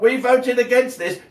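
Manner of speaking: high, loud mocking quote; mocking SNP voice. Ranter mocks SNP MPs, high loud sneer. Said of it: "so they can say to Scotland".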